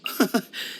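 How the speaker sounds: short, high breathy laugh; light amused chuckle. Acted. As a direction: chuckle at what you are shown. Reacting to someone's training regimen.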